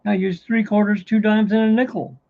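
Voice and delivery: mock earnest simpleton. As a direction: deliver the punchline proudly. Punchline, Pollock proudly explains.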